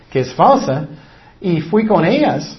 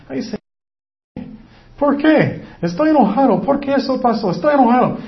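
high, complaining cry to God; frustrated prayer; 8 semitones higher, faster than usual. Retold or imitated. Believer acting out an angry prayer, high complaint. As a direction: whine at the Lord, upset. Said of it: "tú estabas pensando".

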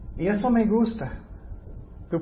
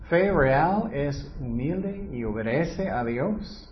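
ordinary talk

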